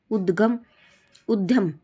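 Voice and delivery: usual speaking voice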